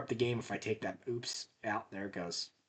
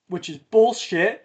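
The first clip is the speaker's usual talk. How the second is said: slow, louder and higher, stretching out "bullshit"; disgusted outburst; 9 semitones higher, 11 dB louder than usual. Real, not acted. Griping to viewers about sell-back money, slow disgusted outburst. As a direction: spit out your disgust at the game. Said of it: "I'm allergic to bullshit".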